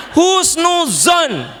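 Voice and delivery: Husnuzon drawn out slowly, high and loud; proclaimed shout. Real, not acted. A preacher drives home his key word to the congregation, shouting. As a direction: cry out husnuzon to the whole audience.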